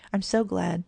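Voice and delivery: ordinary delivery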